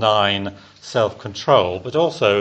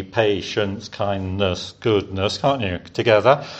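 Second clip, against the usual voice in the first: normal talking voice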